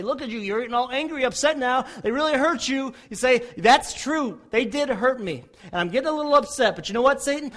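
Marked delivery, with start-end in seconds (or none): 0.0-2.9 louder, mock-goading tempter; a lightly voiced taunting devil; taunting Satan. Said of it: "When Satan comes and say". 3.6-7.5 defiant reply. Retold or imitated. Answering Satan defiantly.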